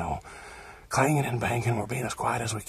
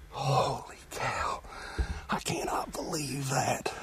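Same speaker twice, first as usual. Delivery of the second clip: slow, awed whisper; 5 dB quieter, slower than usual. Real, not acted. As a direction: breathe it out in disbelief. Hunter whispering after the shot.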